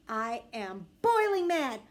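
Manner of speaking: ordinary delivery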